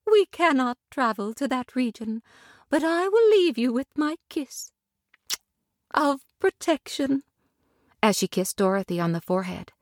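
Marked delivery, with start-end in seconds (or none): 0.1-7.2 tender lady. Acted. Glinda tenderly welcoming Dorothy to Munchkin Land. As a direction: welcome her gently and graciously.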